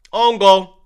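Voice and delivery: usual speaking voice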